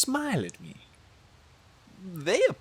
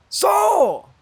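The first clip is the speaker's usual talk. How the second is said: bold call; 13 semitones higher, 6 dB louder than usual. Acted. Bold opener to turkey.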